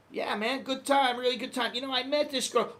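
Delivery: high, eager gush; gushing reply. Retold or imitated. To a coworker asking about the holiday, high eager gush. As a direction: bubble over about the trip.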